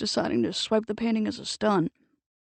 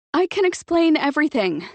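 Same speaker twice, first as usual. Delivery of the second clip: higher, louder call from a distance; 7 semitones higher, 6 dB louder than usual. Acted. Octavia running toward Mitch and Nick, loud high call. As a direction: shout it across the street.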